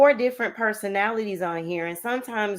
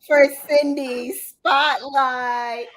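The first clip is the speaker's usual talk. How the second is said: announcer voice; 4 semitones higher, 5 dB louder, much slower than usual. Acted. Host announces applause.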